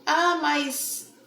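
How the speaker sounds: ordinary talk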